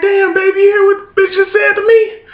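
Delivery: high-pitched outraged whine to his wife; high indignant whine. Retold or imitated. Husband turning to his wife, squealing high in shock. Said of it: "I'm like".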